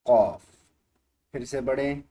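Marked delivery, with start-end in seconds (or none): 0.1-0.5 क़ाफ़ shouted with a falling end; loud call. Shown as a demonstration. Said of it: "जी बताइए". (ज़ोर से क़ाफ़)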